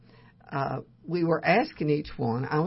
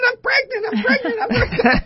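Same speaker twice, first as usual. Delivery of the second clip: high, loud giddy laughing repeat; 7 dB louder than usual. Retold or imitated. Friend on the phone, announcing pregnancy, giddy and laughing. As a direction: squeal it out like giddy news. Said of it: "she was just giddy".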